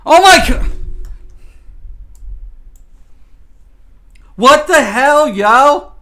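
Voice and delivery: loud, high exclamation of disbelief; startled yelling at the game; shocked outburst. Real, not acted.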